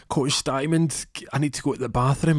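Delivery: pleading to coach. Retold or imitated. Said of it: "he says to the coach".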